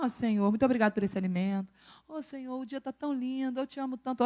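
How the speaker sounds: soft, calm murmured prayer; gentle prayer. Shown as a demonstration. Demonstrating simple thanks to God, soft prayer. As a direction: pray it quietly and tenderly. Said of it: "Oração".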